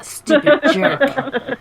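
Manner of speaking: slow, laughing high mock growl. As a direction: spit it out slowly through laughter. Mimicking the EVP voice, laughing.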